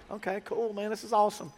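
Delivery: hammed-up polite enthusiasm; awkward enthusiasm. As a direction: fake being cool about it. His retold awkward reply.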